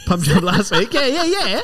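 laughing echo of the tease. Real, not acted. Laughing repeat of friend's jab.

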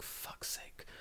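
under-the-breath whispered swear; whispered curse. Retold or imitated. Whispering a curse while spotting the daikon on the table. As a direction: hiss it to yourself in dread.

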